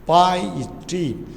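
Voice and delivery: पाइटी very slowly, each syllable drawn out. Shown as a demonstration. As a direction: stretch and separate the syllables of पाइटी. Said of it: "वो उसका syllable कहलाएगा". (पाइटी का हर syllable खींच कर, अलग-अलग)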